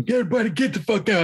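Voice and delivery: mock angry man telling everyone out; quoting the friend who cleared the yard, high and sharp; angry man yelling. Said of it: "the quote unquote angry man".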